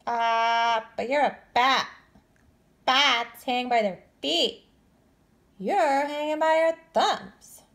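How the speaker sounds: high, loud, slow bat voice; a bat pointing out the obvious; curious bat. Acted. Bat correcting Stellaluna, high and loud.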